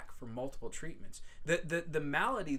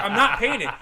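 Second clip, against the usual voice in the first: defiant; 10 semitones higher, 14 dB louder than usual. Retold or imitated. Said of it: "I was like".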